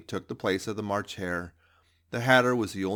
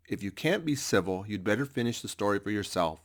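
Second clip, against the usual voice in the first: usual speaking voice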